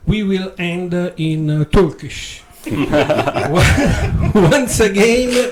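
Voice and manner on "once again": laughing through the words, pitched up and louder; giggling as he opens the show. Real, not acted. Radio host to listeners, laughing out loud.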